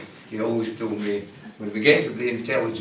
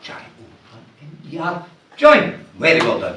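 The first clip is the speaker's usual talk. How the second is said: normal talking voice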